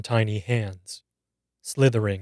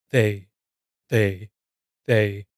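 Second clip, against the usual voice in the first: frightened stammer, words catching; teenage girl stammering into the phone at night; slower than usual. A narrator's reading. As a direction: stammer out the same word in fear. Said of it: "I stammered".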